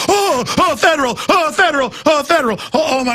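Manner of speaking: high, fawning gasps of reverence; mock awe. Imagined crowd swooning at a federal shirt, high mock awe. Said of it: "and people just".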